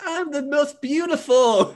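high, slow, preening boast; vain diva. Retold or imitated. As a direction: sing out your own beauty. Lilymon boasting in the factory.

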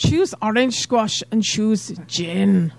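put-on slow Scottish accent, savouring each word; slow Scottish movie-monologue parody. [name] parodying Trainspotting in a slow Scottish accent. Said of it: "[name]'s doing a Scottish accent".

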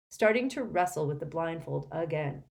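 ordinary delivery

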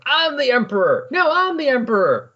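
mock high-pitched bickering claimants; whiny high voices, stretched out in protest; squabbling rivals. Rival Skrulls each claiming the throne, mock squabbling.

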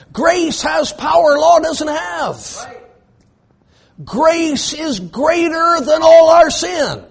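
slow, high, loud preaching; emphatic pulpit delivery; preaching at full force. Acted. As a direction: drive each word home slowly. Preacher proclaiming grace.

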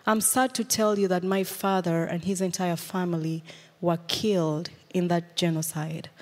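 ordinary talk

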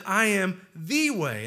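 THE said as a long "thee", slow; stress on THE. Shown as a demonstration. Preacher stressing what Jesus said, stress on THE. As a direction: hit THE as a drawn-out "thee". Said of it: "I would emphasize the fact".

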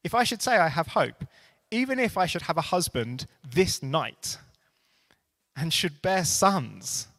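Naomi's plea read from the pulpit, swelling then falling; impassioned scripture reading. Acted. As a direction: build the plea, then let it sink. Reading scripture aloud, building.

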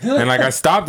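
normal talking voice

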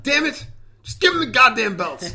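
high, loud exasperated yell; frustrated shouting at the match; pissed-off outburst. Retold or imitated. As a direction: replay a furious fan's demand. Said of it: "I was pissed. I was like".

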